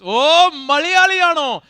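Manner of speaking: loud, high, drawn-out cheer; excited crowd shout. Real, not acted. Host hails Keralites in the audience, excited shout. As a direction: holler it out long and high.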